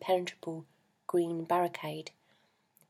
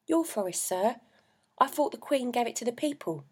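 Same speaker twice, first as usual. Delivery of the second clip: a hint of a puzzled boy; 6 semitones higher than usual. A narrator's reading. Boy questions the man.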